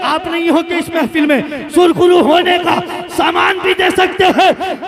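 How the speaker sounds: high, loud, defiant oratory; full-force preaching. Real, not acted. Preacher warning those who insult the Prophet, full-force preaching. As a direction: shout it to the whole crowd in driving bursts.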